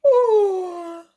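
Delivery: big sleepy yawning oh; drowsy yawn sound. Acted.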